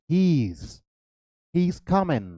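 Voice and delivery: "He's" run into one syllable; contracted form. Shown as a demonstration. Teacher demonstrates pronouncing "He's" for students, contracted form. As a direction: model "He's" as a contraction, alone then in a phrase. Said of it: "pronounce करेंगे तो हम इसे क्या".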